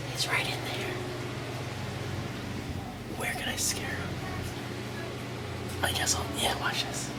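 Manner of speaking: hushed sneaky whisper. Real, not acted. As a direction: whisper so he can't hear. Sneaking to scare Chris.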